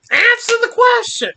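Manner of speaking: sharp scolding shout, pitched high; stern demand. Acted. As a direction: bark it at the child like an angry parent. Scolding a child.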